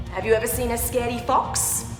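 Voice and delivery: usual speaking voice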